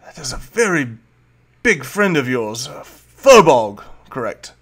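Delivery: eye the big friend with showy curiosity; Volo curious. Acted. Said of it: "he looks up and goes".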